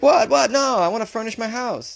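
put-on high-pitched indignant teen protest; protesting kid. Kid turned away from ikea, protesting.

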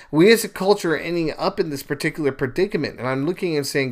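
ordinary talk

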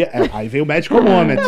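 laughing. Real, not acted. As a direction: let the laugh spill into the words. Laughing while telling. (rindo ao contar)

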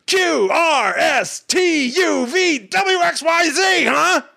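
high, slowly building to a shouted alphabet; manic Cage alphabet rant. Retold or imitated. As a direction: climb the alphabet into a frantic yell. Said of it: "he's like".

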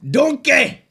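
high, booming Scottish ogre shout; loud cartoon ogre bellow; Shrek impression. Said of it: "I can do, I can do Shrek".

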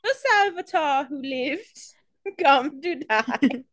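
high, slow mock British villain; drawn-out, hammed-up fake British; Harry Potter British. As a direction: intone it in a bad British accent. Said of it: "He watched all eight Harry Potter movies and said".

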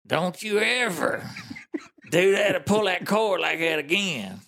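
slow, high-pitched angry scolding; big mad retelling. Retold or imitated. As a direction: imitate an irate man laying down the law. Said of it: "he was big mad".

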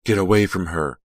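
loud shouted cry; sudden cry. Acted. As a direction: burst out at them. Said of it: "The cry burst from Delia's throat".

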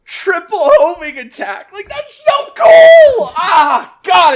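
high, loud, slow excited yell; excited shout. Real, not acted. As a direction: cheer like a thrilled fan.

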